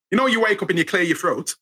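ordinary talk

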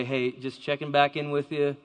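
ordinary talk

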